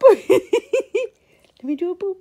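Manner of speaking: laughing. Real, not acted. Cue while laughing.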